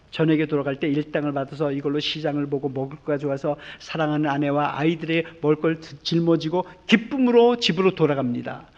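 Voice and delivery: ordinary talk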